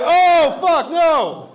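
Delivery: very loud, high yell of dismay; hammed-up horrified shout; horrified outcry. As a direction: cry out as a whole panicking cabin. Passengers react in horror together.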